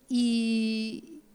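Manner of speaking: a real catch of tears before speaking; breaking into quiet tears over her own selfishness; choked-up sob. Real, not acted. A woman confessing to the congregation, choking up.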